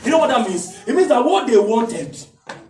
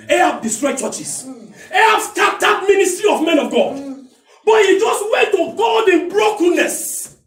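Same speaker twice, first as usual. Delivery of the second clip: rising, loud, higher preacher's cry, slowing at the end; preaching at full force; 9 semitones higher, 4 dB louder than usual. Acted. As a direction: hammer the point to the congregation. Preacher building to climax.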